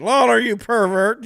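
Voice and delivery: loud, high, drawn-out mock outrage; Terry Funk. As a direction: holler the insult in an exaggerated impression. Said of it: "he said".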